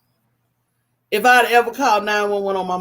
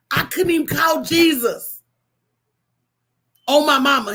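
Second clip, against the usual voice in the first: raised, indignant declaration; emphatic exclamation; 3 semitones higher than usual. Real, not acted. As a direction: cry out that she couldn't even call Jesus. Exclaiming about a strict mother.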